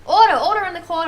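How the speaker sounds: loud, high call over an uproar; booming acted command; judge demanding order. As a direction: shout the courtroom down as judge. Judge calls court to order.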